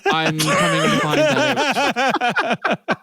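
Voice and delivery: talking through laughter, slowed down; laughing. Real, not acted. Telling friends about the long drive, laughing. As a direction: laugh it out, amused at himself. Laughing at his own story.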